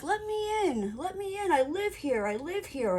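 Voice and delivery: high, insistent begging; pleading drunk man. Retold or imitated. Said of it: "the guy's like".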